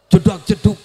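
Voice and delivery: loud, punchy "jedak-jeduk" thumping; imitated club beat. Preacher shows the listeners what clubbing sounds like, imitated thumping beat. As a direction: mimic the thump of nightclub music loudly. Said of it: "Tahu dugem, Bu?".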